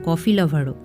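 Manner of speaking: usual speaking voice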